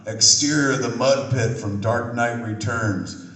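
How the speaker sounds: ordinary talk, nothing marked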